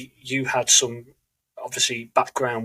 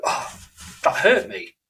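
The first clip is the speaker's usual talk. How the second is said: mock hurt; 6 semitones higher than usual. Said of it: "you're choosing to go".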